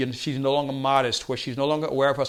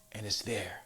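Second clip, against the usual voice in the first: hushed awe; 6 semitones lower, 10 dB quieter than usual. Acted. Baby born in one push.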